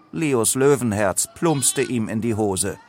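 ordinary delivery, nothing marked

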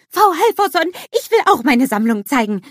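high, loud eager snowman; excited little snowman shouting; Olaf calling out eagerly. A narrator's reading. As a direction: call to the teacher, bursting to go. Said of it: "ruft er".

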